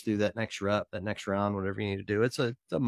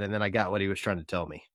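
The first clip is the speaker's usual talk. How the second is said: usual speaking voice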